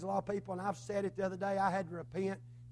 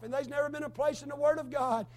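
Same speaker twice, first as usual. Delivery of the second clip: normal talking voice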